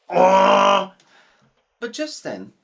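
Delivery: a big slow dramatic pause-maker; suspense. A narrator's reading. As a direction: stretch it out for suspense. Storyteller builds suspense.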